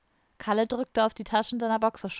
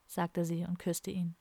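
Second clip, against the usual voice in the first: usual speaking voice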